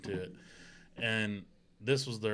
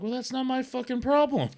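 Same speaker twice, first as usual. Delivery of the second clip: much higher, sharp comeback; mock angry retort; 11 semitones higher, 3 dB louder than usual. Husband retorts to the neighbor, high mock outrage. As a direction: fling it back at her.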